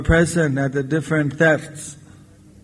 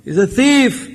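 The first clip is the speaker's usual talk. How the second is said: raised, high-pitched cry of alarm; villagers' outcry; 6 semitones higher, slower than usual. Acted. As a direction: call out the alarm like an alerted villager. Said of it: "everyone would be saying".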